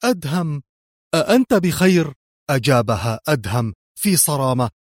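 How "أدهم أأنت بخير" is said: high, worried cry; pained, urgent question; anguished call. A narrator's reading. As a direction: call out in anguish for someone dear. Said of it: "وهتفت في لوعة".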